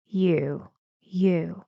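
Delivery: "You" going down; falling intonation. Shown as a demonstration. Teacher isolates the last word, falling "You". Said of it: "Goes down".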